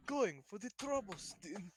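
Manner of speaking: put-on loud, high, slow Russian accent; Zarya impression. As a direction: boom it out like Zarya. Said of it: "think of fucking Zarya and going".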